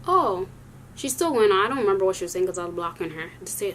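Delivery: ordinary delivery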